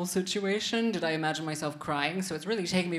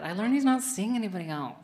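more excited; 3 semitones higher, faster than usual. Shown as a demonstration. Comedian urging the audience to get excited, announcing excitedly. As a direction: rush it out like great news. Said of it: "Get more excited about it".

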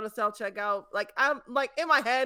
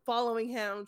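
normal talking voice

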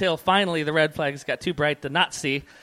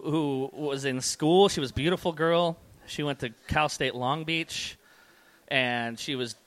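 ordinary talk, nothing marked

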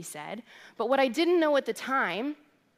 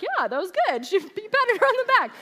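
clueless guys; 11 semitones higher, 6 dB louder than usual. Retold or imitated. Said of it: "every guy was like".

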